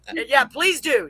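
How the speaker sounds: normal talking voice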